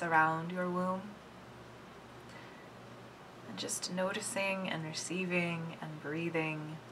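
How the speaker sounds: soft, unhurried meditation voice; quiet, soothing body-awareness guidance; guided meditation. Real, not acted. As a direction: guide them gently, with long pauses between phrases. Leads a meditation.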